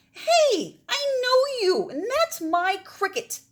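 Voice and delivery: put-on a child catching a thief, outraged; indignant girl. Maggie catching the pirate with her cricket, high indignant voice.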